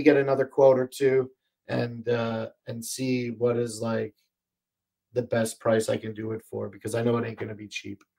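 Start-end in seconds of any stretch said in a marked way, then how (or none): none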